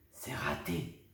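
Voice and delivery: soft whisper. A narrator's reading. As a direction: whisper it into a friend's ear. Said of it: "chuchote Lulu Grenadine". (chuchoté à l'oreille)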